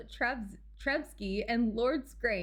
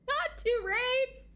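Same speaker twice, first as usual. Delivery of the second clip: excited squeal; 13 semitones higher, much slower than usual. Real, not acted. Excited squeal over incoming raid.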